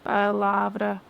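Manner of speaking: "Palavra" in an even, mechanical robot voice. Shown as a demonstration. Teacher asking learners to say palavra like a robot. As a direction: say it like a robot, syllables level. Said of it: "como se fosse um robô".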